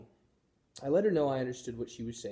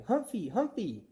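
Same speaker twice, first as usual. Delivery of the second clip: put-on high, drawn-out little-boy call; excited child's shout; 9 semitones higher, slower than usual. A narrator's reading. Little boy rushing to the table, excited call. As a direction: call the hamster gleefully.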